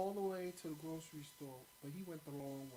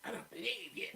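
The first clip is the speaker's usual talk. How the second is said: huffy whisper; 8 semitones higher than usual. Retold or imitated. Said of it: "he stormed upstairs".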